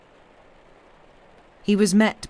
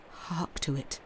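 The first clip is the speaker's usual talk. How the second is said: hushed, much softer; a frightened hush by the fire, far softer; whispered; 9 dB quieter than usual. Acted. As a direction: breathe it as if afraid to be heard. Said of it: "he whispered".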